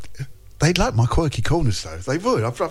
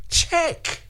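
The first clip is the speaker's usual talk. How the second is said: normal talking voice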